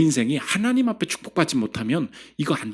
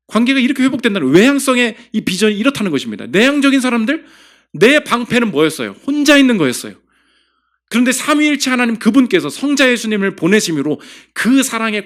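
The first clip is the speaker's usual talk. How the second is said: higher and louder, fervent sermon climax; full-force preaching; 8 semitones higher, 7 dB louder than usual. Acted. Pastor urging the congregation about Jesus, full-force preaching. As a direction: preach it out with rising fire.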